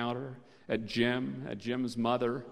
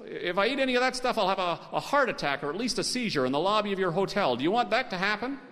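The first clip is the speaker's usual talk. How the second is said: high-pitched, rattled-off warning; hammed-up, agitated customer rant; worked-up threat; 6 semitones higher, 3 dB louder than usual. A narrator's reading. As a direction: escalate into an overblown complaint. Dave rants a threat to manager.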